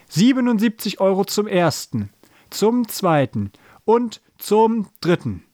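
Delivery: high, slowing going-going-gone call; auctioneer's countdown. A narrator's reading. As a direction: draw out the final count for suspense.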